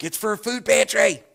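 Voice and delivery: high, exasperated mock outburst; defensive protest. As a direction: insist on it to clear your name. Defends himself to judging cashier.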